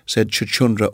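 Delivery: ordinary delivery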